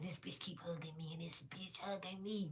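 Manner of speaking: whispered mimicry of an annoyed coworker; hushed, barely voiced retold griping; imitating grumbling. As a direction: mutter the complaint under your breath. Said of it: "She's like".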